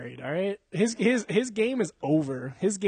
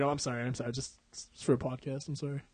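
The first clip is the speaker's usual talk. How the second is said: meek apology; 3 semitones lower, 9 dB quieter than usual. Retold or imitated. Boyfriend apologizing to offended girlfriend, meek. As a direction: lower and softer, hammed-up backing down.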